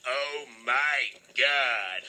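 stunned medic. Retold or imitated. Medic shocked at crash.

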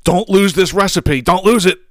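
lightly hammed-up Facebook-lady warning; mock all-caps urgency. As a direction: shout it like a capital-letters post. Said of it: "in capital letters".

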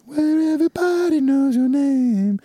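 put-on sing-song British accent, high and slow. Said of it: "reading that in a British accent".

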